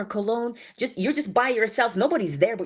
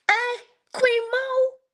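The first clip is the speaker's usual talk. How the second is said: much higher, slow, stretched out; greeting a viewer, much higher and drawn out; excited greeting; much slower than usual. Real, not acted. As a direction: sing out her name in delight.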